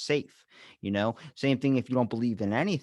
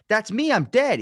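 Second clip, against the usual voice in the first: shocked; 11 semitones higher, 8 dB louder than usual. Retold or imitated. Man sees his own body.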